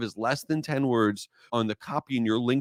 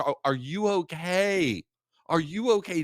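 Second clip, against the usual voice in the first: higher-pitched, lightly acted concern; mock-concerned onlooker, pitched up; concerned passengers; 6 semitones higher than usual. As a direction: imitate worried strangers checking on someone crying. Worried passengers checking on bawling man.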